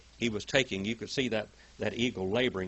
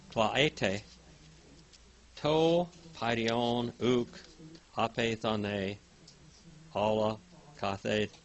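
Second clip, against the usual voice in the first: scripture reading; 5 dB louder, much slower than usual. Acted. Reads Greek verse aloud.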